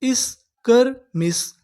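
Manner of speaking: very slow, each syllable drawn out; इस्कर्मिश stretched syllable by syllable. Shown as a demonstration. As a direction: sound out इस्कर्मिश slowly. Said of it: "हमारी पूरी meaning क्या बनी".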